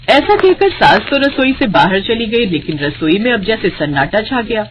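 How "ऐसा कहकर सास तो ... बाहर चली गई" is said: plain reading voice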